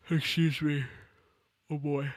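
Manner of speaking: yawning through the words. Real, not acted. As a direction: talk mid-yawn, sleepy. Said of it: "Why am I yawning?".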